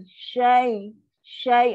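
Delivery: "syai" pushed out, then voice falls; dropping syllable. Shown as a demonstration. Teacher isolates "syai" to show the drop. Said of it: "ah, dia turun".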